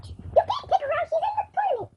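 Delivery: very high, squeaky protest; squeaky character voice. Pretend. Twin defending her sister in the tournament, squeaky voice. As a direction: protest in a tiny high-pitched voice.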